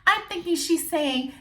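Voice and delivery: ordinary talk, nothing marked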